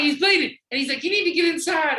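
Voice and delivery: high-pitched mock alarm; imitated freak-out. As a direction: play the panicking partner, urgent and high. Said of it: "His partner freaks out".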